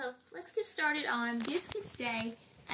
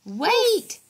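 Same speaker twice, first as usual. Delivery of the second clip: loud, higher call; 5 semitones higher, 10 dB louder, slower than usual. Acted. Mom calling to Biscuit in the story. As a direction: call out to stop the puppy.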